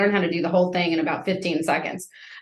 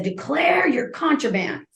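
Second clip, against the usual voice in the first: high, slow, stern guard barking an order; mean authoritative guard; 7 semitones higher than usual. Retold or imitated. Guard orders a scared new inmate, slow and stern. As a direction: play the harsh prison guard demanding contraband. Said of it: "she's mean and authoritative".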